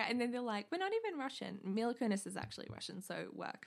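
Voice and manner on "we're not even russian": put-on whiny kid. Kids complaining about the Nutcracker.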